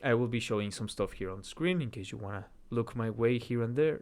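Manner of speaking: ordinary talk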